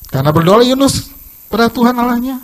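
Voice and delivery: loud, driving sermon delivery; forceful preaching. Acted. Preacher drives the lesson of Jonah home to the congregation, loud and forceful. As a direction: press the point home to the congregation.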